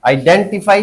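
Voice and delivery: "Identify" with डें stressed; stress demo. Shown as a demonstration. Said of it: "क्या बोलेंगे इसको?". ("Identify" में डें पर ज़ोर)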